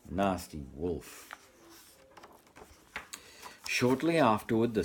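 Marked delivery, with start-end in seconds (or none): 0.1-1.3 soft aside. Real, not acted. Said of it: "aside to the children".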